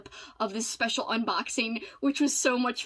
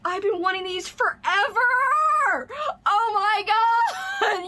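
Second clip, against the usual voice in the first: high, loud, slow squeal of joy; real overjoyed yell; excited shout; 11 semitones higher, 4 dB louder, slower than usual. Real, not acted. Opening a long-wanted gift.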